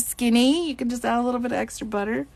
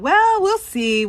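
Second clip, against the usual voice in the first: normal talking voice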